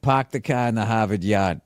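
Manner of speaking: "Park the car in the Harvard yard" with dropped r's, as "pahk the cah"; Boston accent. Shown as a demonstration. Local giving the classic Boston accent line. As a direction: drop every r, Boston style. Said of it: "one thing that they always say".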